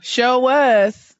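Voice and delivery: high, loud celebrity impression; Tiny. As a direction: call it out high in a put-on voice. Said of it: "I'm trying to sound like Tiny".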